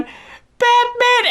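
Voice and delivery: high, drawn-out mock call; imitated calling voice. Imitating the voice at song's end, mock calling. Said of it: "it has a voice going".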